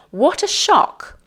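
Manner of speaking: high-pitched demonstrated exclamation of shock; surprise. Shown as a demonstration. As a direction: act taken aback, pitch leaping up. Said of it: "Showing surprise".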